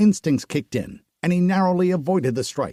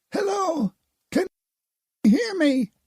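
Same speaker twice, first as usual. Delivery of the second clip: high distorted woman's voice over a comm line; woman calling through a communication rune. A narrator's reading.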